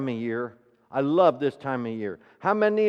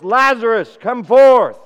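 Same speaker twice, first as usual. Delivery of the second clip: a loud, high call swelling to a shout; loud cry; 7 semitones higher, 13 dB louder than usual. Acted. Jesus calling dead Lazarus out of the tomb, loud cry. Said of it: "he cried out in a loud voice".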